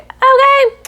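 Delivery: high, loud sassy okay. Real, not acted. Vlogger reacting to a cheating hint, sassy high okay.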